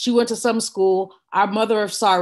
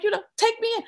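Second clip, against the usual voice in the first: high, softer defiant dare; 11 semitones higher, 4 dB quieter, much faster than usual. Retold or imitated. Daughter daring the officer, high and soft. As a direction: dare him to do it. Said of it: "I'm like".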